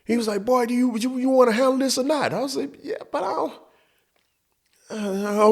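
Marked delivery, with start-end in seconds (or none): 0.5-2.3 impatient dad. Retold or imitated. Dad losing patience. 2.8-3.5 squeaky reluctance. Retold or imitated. Kid self squeaking reluctance.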